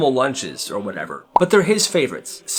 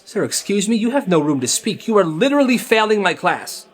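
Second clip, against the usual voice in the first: higher-pitched, affronted comeback; indignant teacher; 6 semitones higher than usual. Retold or imitated. Teacher hitting back at a cheeky student, indignant. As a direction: imitate a teacher putting a student down. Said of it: "She hits him with this".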